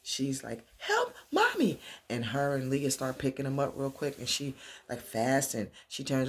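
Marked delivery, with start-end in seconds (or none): 0.8-1.8 put-on high, drawn-out little girl cry for help; child calling out. Little girl calling for help with Mommy, high mock cry.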